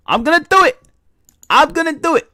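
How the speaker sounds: high, loud pump-up before the reaction; hyped up. Real, not acted. Streamer hyping himself up to answer Seth, excited. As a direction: psych yourself up to go for it.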